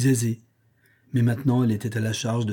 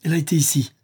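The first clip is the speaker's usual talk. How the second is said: raised, excited exclamation; higher, elated cry; cry of joy; 5 semitones higher, 4 dB louder, much faster than usual. A narrator's reading. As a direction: announce a joyful discovery. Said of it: "poussa un cri de joie".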